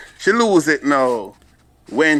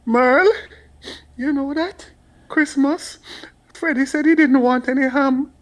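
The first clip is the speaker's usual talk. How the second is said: gossiping family friend; 9 semitones higher than usual. Retold or imitated. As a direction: drag out the shocking news about Christmas. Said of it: "your mother say".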